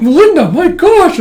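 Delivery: high, loud acted squeal; giddy excitement. Yor greeting long-lost friend Melinda, giddy.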